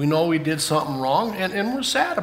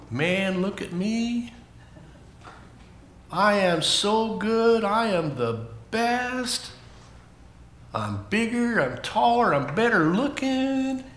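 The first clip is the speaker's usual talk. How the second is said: drawn-out, higher-pitched mock bragging; boastful showing-off; 4 semitones higher, 5 dB quieter, slower than usual. Children's teacher acting out what proud means, boastful. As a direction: lightly act out someone full of themselves.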